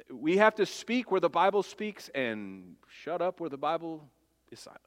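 a sudden whisper to the congregation; whispered, close and confidential; hushed aside. Real, not acted. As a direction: drop to a breathy hush for the warning. Preacher drops to a hush.

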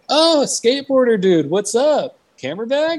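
very high, stretched-out dude greeting; hammed-up, high-pitched stoked hello; enthusiastic clerk. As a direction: imitate an over-friendly guy recognizing someone. Imitating friendly evidence clerk's greeting.

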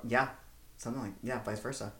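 ordinary talk, nothing marked

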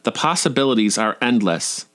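ordinary delivery, nothing marked

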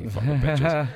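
high, loud joking curse; mock outrage. Pranked passenger to the joking flight crew, mock outrage. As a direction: play at cursing out the pranksters. Retold reply to crew's prank.

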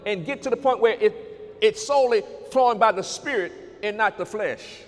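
loud, driving sermon build; preaching at full force. Acted. Preacher thundering at the congregation about the flesh. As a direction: pound each phrase out to the congregation.

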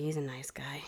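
low whispered nice guy. Real, not acted. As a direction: whisper it fondly. Fond whisper about cat.

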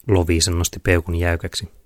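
ordinary delivery, nothing marked

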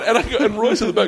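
ordinary talk

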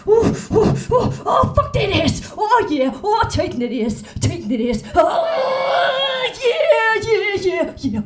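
hammed-up porn moaning, high and loud; over-the-top porn actor cries; audition performance. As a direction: build to a wailing mock climax. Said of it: "Do you have anything prepared".

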